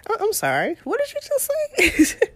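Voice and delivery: high-pitched, incredulous "wait, what?"; stunned disbelief. Retold or imitated. Friend offers to take kids, she's stunned and incredulous. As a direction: act like you can't believe your ears.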